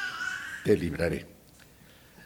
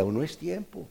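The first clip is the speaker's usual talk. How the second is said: calm potter; 6 semitones higher, 10 dB quieter than usual. Acted. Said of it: "Y me decía".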